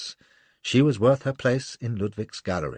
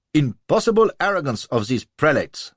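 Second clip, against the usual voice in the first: indignant stout man; 8 semitones higher, 7 dB louder than usual. A narrator's reading. Stout florid man complains.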